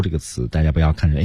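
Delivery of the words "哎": ordinary delivery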